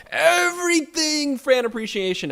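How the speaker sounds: high, slow showy title read; mock grand title. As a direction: ham up the episode name. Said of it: "gonna let Tom actually redo that".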